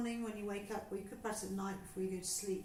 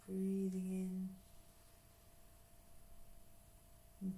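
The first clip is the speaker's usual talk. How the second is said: guided breathing; 3 dB quieter than usual. Acted. Teacher guiding a class through breathing, calm and unhurried. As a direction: talk them gently through each breath.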